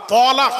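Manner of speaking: mock the wrong তলাক; mispronounced talak. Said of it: "ল আকার কো তালাক". (ভুল উচ্চারণ: তলাক, জোরে)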